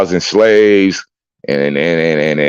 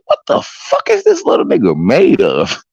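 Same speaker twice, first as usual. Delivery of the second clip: amazed; 11 semitones higher than usual. Retold or imitated.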